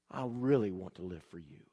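low, soft, earnest plea; hushed prayer. Acted. Believer promising to live for God, hushed prayer. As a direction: pray it quietly and low. Acted prayer of surrender continues.

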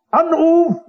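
high, drawn-out page's call across the hotel lobby; hotel page calls out a phone call, announcing loudly. Retold or imitated. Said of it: "und rief".

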